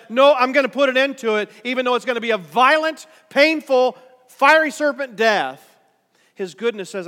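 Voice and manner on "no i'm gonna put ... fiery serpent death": forceful declaration. Acted. God's goodness speaking in sermon.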